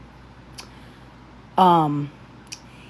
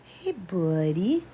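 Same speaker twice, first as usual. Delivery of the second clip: slow, much softer, tender hey buddy; soft slow hello; 8 dB quieter, much slower than usual. Real, not acted. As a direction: coo it gently and slowly to the pet. Greeting the dog softly.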